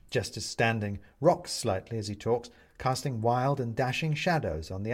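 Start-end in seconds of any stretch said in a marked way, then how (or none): none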